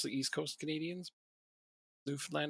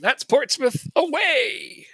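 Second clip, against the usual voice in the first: loud drawn-out announcement of the fixture; excited shout; 11 semitones higher, 10 dB louder, much slower than usual. Real, not acted. Gamer hyping his next away match, slow loud shout. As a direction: call it out like a stadium announcer.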